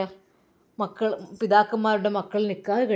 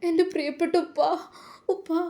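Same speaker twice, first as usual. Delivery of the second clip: higher, overwhelmed call to father; emotional reunion cry; 7 semitones higher than usual. Acted. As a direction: cry out as she embraces him. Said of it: "എന്ന് പറഞ്ഞ് അവൾ ആ പിതാവിനെ കെട്ടിപ്പിടിച്ചു". (കെട്ടിപ്പിടിക്കുമ്പോൾ വികാരഭരിതമായ വിളി)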